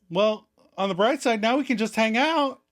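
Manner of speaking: high, chirpy optimism; mock cheerful. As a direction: brightly offer a silver lining in a high voice. Rachel voiced finding the bright side.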